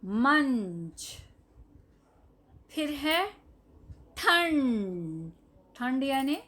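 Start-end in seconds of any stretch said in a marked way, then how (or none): 0.0-1.1 slow, stretched मंच with a clear nasal; teacher modelling मंच for children; drawn-out model word. Shown as a demonstration. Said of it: "म के ऊपर बिंदी है". 4.2-5.3 slow, stretched ठंड with a held nasal; teacher modelling ठंड slowly for pupils; drawn-out model word. Shown as a demonstration. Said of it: "म के ऊपर बिंदी है".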